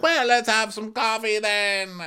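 high, slow put-on voice; mock wife. Karen answering Ray about coffee, mock indulgent. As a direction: answer with exaggerated patience.

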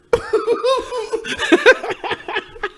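squealing burst of laughter; high-pitched laugh. Real, not acted. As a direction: shriek with laughter. Reacts to baptism speech joke.

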